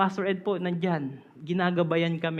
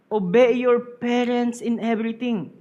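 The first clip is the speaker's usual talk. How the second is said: louder, higher restated Bible verse; scripture reading; 3 semitones higher, 4 dB louder than usual. Acted. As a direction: press the verse home. Rereads verse.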